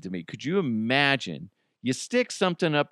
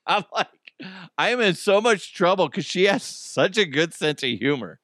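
usual speaking voice

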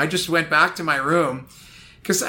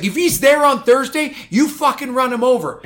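higher, louder mock order; imitating furious coach; 9 semitones higher, 5 dB louder than usual. Voicing angry coach Smitty.

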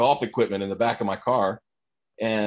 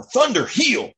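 dog trainer command; 12 semitones higher, slower than usual. Retold or imitated. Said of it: "like a professional dog trainer".

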